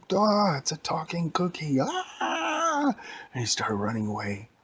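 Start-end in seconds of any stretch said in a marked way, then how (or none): 0.1-1.8 high, loud cartoon panic; panicked scientist. Scientist startled by a talking cookie, mock panic. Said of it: "The scientist said". 1.8-3.0 long, very high mock scream; scientist's scream. Scientist flees the talking cookie, panicked scream. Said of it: "The scientist said".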